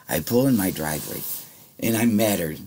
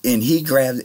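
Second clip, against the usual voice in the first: normal talking voice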